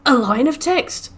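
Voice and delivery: mock being impressed; sarcastic wow. Gamer mocking a tiny game reward with sarcasm. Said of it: "I'm like".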